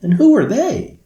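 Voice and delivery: put-on high, loud little girl's voice; a girl asking wide-eyed; child's question. Girl asks the Sandman about lights, high and eager.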